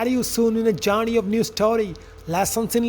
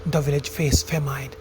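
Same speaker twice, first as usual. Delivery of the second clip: lower, measured storyteller voice; story narration; 6 semitones lower, 4 dB quieter than usual. A narrator's reading. Narrator telling friends of farmer Jonathan and son Joe, story narration. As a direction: narrate it like a fable, evenly.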